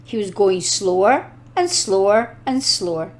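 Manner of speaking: plain reading voice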